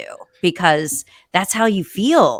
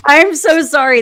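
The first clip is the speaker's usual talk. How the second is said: raised, higher apologetic voice; loud apology; 10 semitones higher, 8 dB louder than usual. Real, not acted. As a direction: call out sorry over noise. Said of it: "I have a leaf blower on my porch right now".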